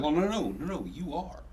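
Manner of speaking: high, emphatic retort; mock exasperated. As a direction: pitch it up, insisting no. Retorting to client in retold exchange.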